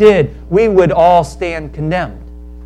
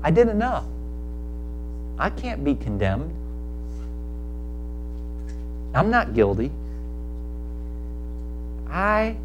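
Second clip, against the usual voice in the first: soft, much quieter, self-assured murmur; smug self-justification; 3 semitones lower, 13 dB quieter than usual. Acted. Believer assures himself quietly he did enough, soft and smug. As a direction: quietly reassure yourself you've earned it.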